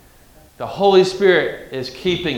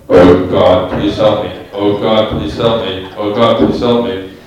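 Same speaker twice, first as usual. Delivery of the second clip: booming deep amplified voice, much lower and louder; vast deep cosmic voice; 8 semitones lower, 7 dB louder than usual. Shown as a demonstration. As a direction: boom it out like a voice from the heavens. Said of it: "The Holy Spirit takes the microphone".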